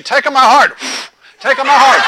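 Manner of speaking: high, loud laughing crowd chant; crowd shouting. Retold or imitated. Crowd as the feather hovers overhead, loud laughing plea. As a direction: beg loudly while cracking up. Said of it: "the people say".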